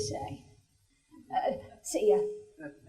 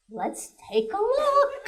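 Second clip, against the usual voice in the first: high, chirpy doctor voice; gynecologist voice; 10 semitones higher than usual. Acted. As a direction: say it brightly up high. Gynecologist quoted in joke.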